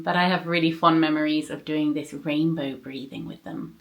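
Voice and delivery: ordinary talk, nothing marked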